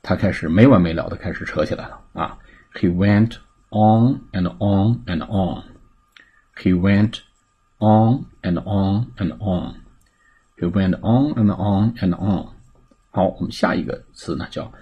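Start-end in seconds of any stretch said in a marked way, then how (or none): 2.7-12.5 slow repeat-after-me delivery, each "on" drawn out; measured, stretched-out teaching demo; slow model reading. Shown as a demonstration. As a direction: model the phrase slowly for learners. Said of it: "来做跟读练习".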